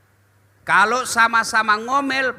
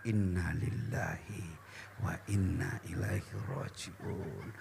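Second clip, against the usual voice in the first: much softer, lower, slow murmur; quiet slow prayer murmur; soft recitation; 15 dB quieter, slower than usual. Shown as a demonstration. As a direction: murmur the verse under your breath.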